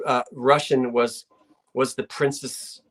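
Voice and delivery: ordinary talk, nothing marked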